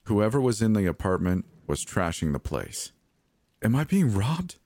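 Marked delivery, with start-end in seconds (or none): 3.6-4.6 high, alarmed question; frightened. A narrator's reading. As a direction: ask it in pitched-up panic. Frightened woman hears intruder trashing apartment.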